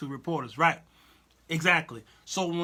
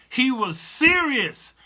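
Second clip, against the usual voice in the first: serious stretched slow and high, louder; emphatic serious; 7 semitones higher, 7 dB louder, slower than usual. Shown as a demonstration. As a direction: lean hard on serious, drawn out. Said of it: "It wasn't jest".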